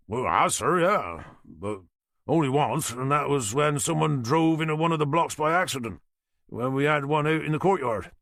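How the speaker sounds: a Norfolk boatyard man answering the inspector; thick Norfolk accent. Acted. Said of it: "he said with a thick Norfolk accent".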